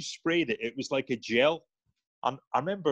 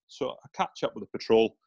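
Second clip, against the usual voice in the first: usual speaking voice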